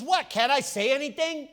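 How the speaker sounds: a little brother objecting loudly; indignant protest. Retold or imitated. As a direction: push back like a wronged little brother. David protests to his brother.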